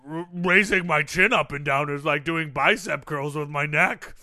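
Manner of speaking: lightly voiced deep, loud boastful fat guy; Tubby Tom character voice. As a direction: boast about the weight loss in character. Guest stays in Tom character.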